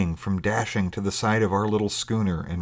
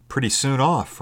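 mildly miffed, pitched higher; slightly injured; 6 semitones higher than usual. A narrator's reading. Will commenting on stranger's quick departure, slightly injured. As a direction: remark with a touch of hurt. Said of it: "with perhaps just a sense of injury".

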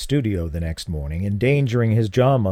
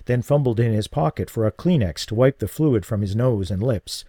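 ordinary talk

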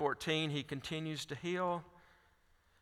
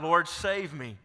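crying out; 3 semitones higher, 5 dB louder, slower than usual. Acted. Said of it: "he cried out".

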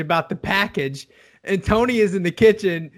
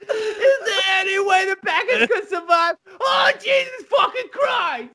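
much higher, laughing mock shout; mock yelling Tony; 12 semitones higher than usual. Tony yelling in the kitchen about the package, laughing mock yell. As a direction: yell it over and over, cracking up. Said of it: "Tony's yelling it over and over".

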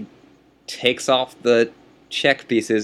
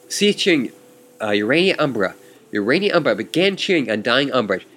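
ordinary talk

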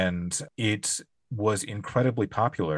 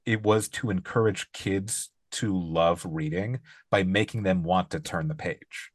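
ordinary delivery

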